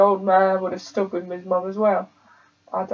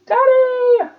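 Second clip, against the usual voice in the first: put-on child calling out; 6 dB louder than usual.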